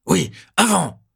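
very high, loud furious yell; choking with rage. A narrator's reading. Lieutenant choking with rage at Lupin, a loud high yell. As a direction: nearly choke on rage. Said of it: "Il s'étouffe presque de rage".

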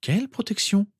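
high, puzzled question; uncomprehending. A narrator's reading. Mother doesn't understand about the protection, puzzled. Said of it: "continua-t-elle à ne pas comprendre".

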